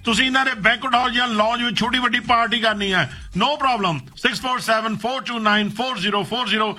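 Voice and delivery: upbeat commercial pitch; radio ad read. Acted. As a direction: sell it like a radio spot, spelling out the number. Reads a catering ad.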